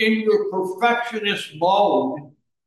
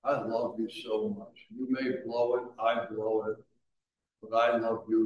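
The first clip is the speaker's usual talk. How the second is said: loving voice; 6 semitones lower, 8 dB quieter than usual. Retold or imitated. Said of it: "comes up to you and says".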